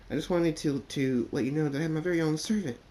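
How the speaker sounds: high, eager filly voice, bragging; proud little filly. Acted. Sweet Belle figure proudly tells.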